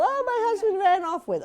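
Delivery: high mock lament through a laugh; high laughing mock-tragic voice; insipid movie wife. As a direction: parody a whimpering wife. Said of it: "no insipid little".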